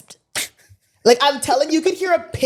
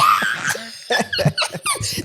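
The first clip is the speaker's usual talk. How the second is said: very high squealing laugh; acted-out group screaming; celebratory shriek. Acting out screaming in the elevator.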